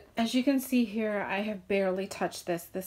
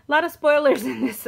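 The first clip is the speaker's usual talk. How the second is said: laughing; 5 semitones higher, 8 dB louder, faster than usual. Real, not acted. Laughing about spoilers.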